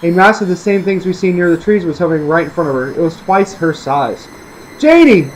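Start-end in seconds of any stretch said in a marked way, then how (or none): 4.8-5.3 high, loud scream of her name; a mother's panicked scream; frightened scream. A narrator's reading. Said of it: "Angie screamed".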